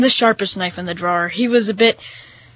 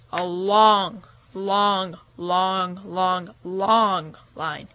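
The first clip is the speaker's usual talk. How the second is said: each "long" lower, soft and stretched; drawn-out repetition; 3 semitones lower, 6 dB quieter, much slower than usual. A narrator's reading. Storyteller describing the endless line, drawn-out "long". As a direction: pause after every "long", stretching the vowel.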